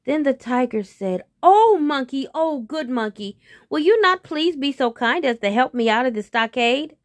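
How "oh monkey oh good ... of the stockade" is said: pleading. Acted. Trapped tiger begs monkey for help.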